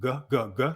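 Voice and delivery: "G" as separate short "guh" syllables; hard G sound. Shown as a demonstration. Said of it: "Personally, the G works more".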